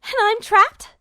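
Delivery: high, loud frightened shriek. A narrator's reading. Trapped girl shrieking at Duo, high and loud. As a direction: voice shooting up in panic. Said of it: "Hild's voice went up in a shriek".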